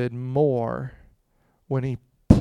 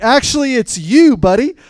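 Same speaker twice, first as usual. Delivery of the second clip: loud, high-pitched accusing retort; punchy mock accusation; acted confrontation; 10 semitones higher, 12 dB louder than usual. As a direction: call him out, loud and high. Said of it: "And Nathan's like".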